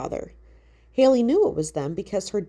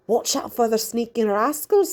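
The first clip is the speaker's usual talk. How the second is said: put-on higher, lightly Irish old woman; a hint of an Irish great-grandmother warning; 5 semitones higher than usual. A narrator's reading. Una warns Haley about rascals, light Irish lilt.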